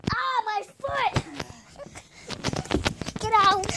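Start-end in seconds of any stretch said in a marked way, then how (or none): 0.1-1.2 high, slow yelp of pain; pained cry. Real, not acted. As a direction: cry out at a hurt foot.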